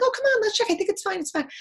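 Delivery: high, loud breezy coaxing; coaxing friend. Retold or imitated. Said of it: "I'm like".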